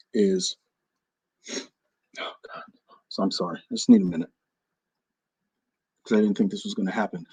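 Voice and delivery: low, very soft, breaking up; overcome. Real, not acted. Host pretending to be overcome before big news, near tears. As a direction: barely hold it together.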